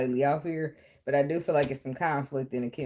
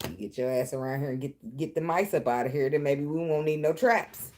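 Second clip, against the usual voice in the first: ordinary talk, nothing marked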